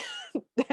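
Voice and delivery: sudden high-pitched laugh. Real, not acted. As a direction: burst into a squeaky laugh. Laughs at the scene.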